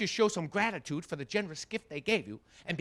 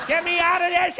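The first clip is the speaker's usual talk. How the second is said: put-on stubborn old mother; 10 semitones higher, 11 dB louder than usual. Elderly mother refusing the booster seat, high loud defiance. Mother protests in shopping cart.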